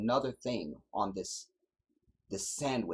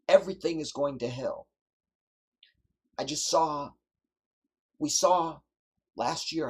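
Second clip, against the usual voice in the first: high, loud pulpit exhortation; fiery preaching; 5 semitones higher, 6 dB louder than usual. Real, not acted. Preacher urging his people to stand firm, in loud fiery preaching. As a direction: drive the charge home from the pulpit.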